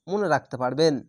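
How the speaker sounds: ordinary talk, nothing marked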